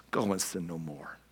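soft, low and tender; gentle. Retold or imitated. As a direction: give the command kindly, under the breath. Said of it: "that's how he said it".

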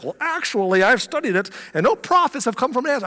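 high-pitched know-it-all nerd voice. Acted. Nathanael pushing back at Philip, as a nerd. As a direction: correct them like a pedantic nerd. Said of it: "like, a nerd".

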